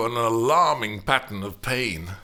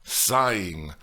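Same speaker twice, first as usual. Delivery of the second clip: ordinary delivery, nothing marked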